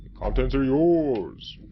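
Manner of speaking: loud, high, drawn-out announcement; hammed-up grand presentation; odd mock proclamation. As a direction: proclaim it slowly, swelling on the last word. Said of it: "I don't know why I said it that way".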